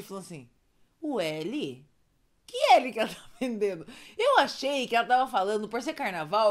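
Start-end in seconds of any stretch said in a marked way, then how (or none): 1.0-1.9 low, soft naive question; innocent puzzlement. Retold or imitated. Storyteller replaying her confusion at the call, soft innocent question. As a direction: ask it small and clueless. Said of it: "e eu, muito inocente".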